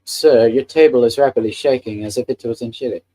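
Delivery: a light put-on Irish accent reading chat; playful Irish lilt on a viewer comment. Shown as a demonstration. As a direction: reads the chat line in a mock Irish accent. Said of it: "I just read that in an accent".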